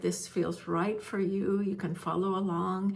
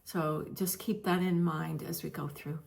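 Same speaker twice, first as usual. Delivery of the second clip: usual speaking voice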